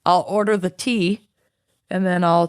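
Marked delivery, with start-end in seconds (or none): none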